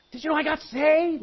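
high, bright news burst; excited convert. Retold or imitated. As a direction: share the good news eagerly. Excited believer tells coworker.

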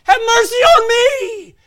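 high, drawn-out desperate shout; crying out. Retold or imitated. Blind beggar begs Jesus for mercy, crying out. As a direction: plead at full force. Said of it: "And he cried out".

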